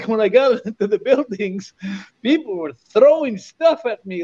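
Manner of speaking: normal talking voice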